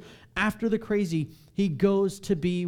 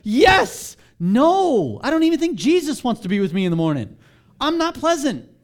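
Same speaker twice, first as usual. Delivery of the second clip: higher, louder, fast flustered protest; mock flustered protest; 8 semitones higher, 11 dB louder than usual. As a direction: blurt it like a guilty grump. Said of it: "And I'm like".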